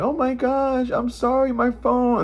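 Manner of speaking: high, sugary feigned innocence; fake innocent apology. To the scammer, a high fake-innocent apology. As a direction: play dumb sweetly to fool him. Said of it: "I'm acting like I don't know what's going on".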